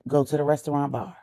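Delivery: ordinary delivery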